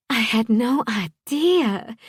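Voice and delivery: high, slow, drawn-out amazement; amazed. A narrator's reading. Wife learning who the guest is, amazed. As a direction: stretch it out high in astonishment.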